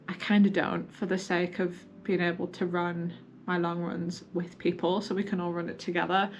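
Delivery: normal talking voice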